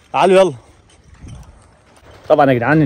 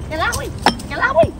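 high shriek; 8 semitones higher, 4 dB quieter than usual. Acted. Mother shrieks as her son falls.

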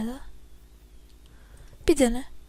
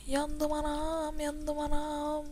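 mock lament; 3 semitones higher, 10 dB quieter, much slower than usual. Player mock-laments his character burning.

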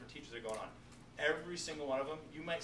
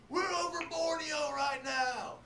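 high, loud, slow shouted host intro; hyped TV host; 11 semitones higher, 5 dB louder, slower than usual. Retold or imitated. Teacher imitating Bear Grylls shouting from the helicopter, high and loud. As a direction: shout it like a showman on a helicopter skid. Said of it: "and he's like".

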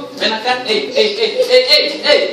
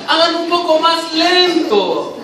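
high, stretched-out instruction; drawn-out slow; 6 semitones higher, slower than usual. Shown as a demonstration. Director asks actors to replay the scene slower, drawn-out delivery. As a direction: drag the words out slowly, pitched up.

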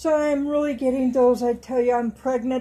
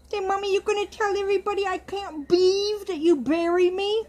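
put-on childlike doll voice; 6 semitones higher than usual. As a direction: speak for the doll as a small child. Voicing the doll again.

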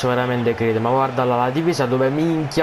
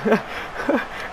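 breathy giggle; 7 semitones higher, 5 dB quieter than usual. Real, not acted. Risata dopo il gol.

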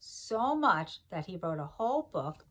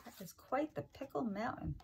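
ordinary delivery

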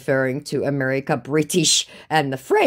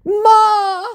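high, loud wail; mock heartbroken; 10 dB louder than usual. Scammer wounded by her insults, mock heartbroken. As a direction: cry out in hammed-up heartbreak. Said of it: "he declares with a broken heart".

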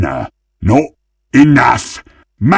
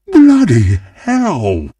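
high, slow exclamation; hammy exasperated cry; outraged cry; 9 semitones higher, slower than usual. Acted. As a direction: stretch it out in high-pitched outrage. Game character exclaims at bad turn.

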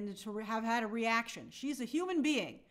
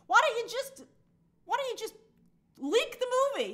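higher, incredulous phone rant; pretend outraged call; 10 semitones higher, 3 dB louder than usual. Studio calls the editor in mock outrage, pitched up. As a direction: play the studio boss yelling at the editor. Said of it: "on the phone to the editor being like".

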